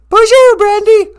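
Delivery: very high cooing voice; high pet voice. Acted. A squeaky cooing voice praising the host's intro. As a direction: coo it like baby talk.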